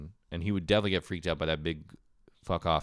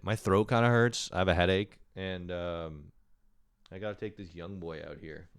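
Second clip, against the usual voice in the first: ordinary talk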